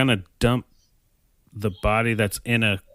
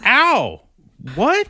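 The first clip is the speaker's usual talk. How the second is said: high, loud yelp; mock pained surprise; 13 semitones higher, 5 dB louder than usual. Husband is hit on the head, high mock yelp. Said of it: "so he's like".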